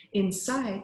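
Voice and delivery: ordinary delivery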